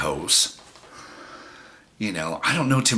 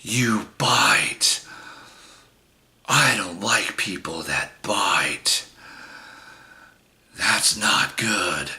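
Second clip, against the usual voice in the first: imitating a slow, whispery scolding; drawn-out hoarse whisper with long pauses; creepy accusation; much slower than usual. Date staring at the man who bit her, slow whispered accusation. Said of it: "And she just goes like this".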